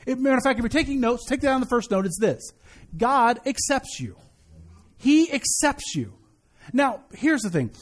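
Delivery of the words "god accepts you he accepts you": ordinary talk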